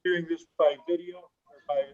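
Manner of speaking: muffled call audio; muted. Real, not acted. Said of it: "you sound a little muted".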